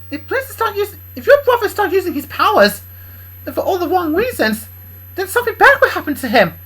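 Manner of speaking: high, loud pleading character voice; concerned Kendra. Acted. Kendra warns Bob in character.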